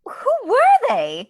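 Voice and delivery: dying to know; eager question. Acted. Mina eager for details.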